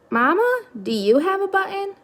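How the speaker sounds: put-on high, childlike little bird voice; gosling voice. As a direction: sing it up like a small child.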